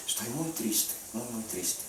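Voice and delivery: soft, almost breathless murmur of sadness; deadpan quiet. Retold or imitated. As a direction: say it hushed and flat, barely there. Calm widow claims sadness. (murmurado, casi sin voz, la viuda serena)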